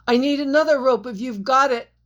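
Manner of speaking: loud, fast yell. A narrator's reading. Jack yelling up to the miners for a rope. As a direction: shout up the cliff. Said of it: "Jack yelled".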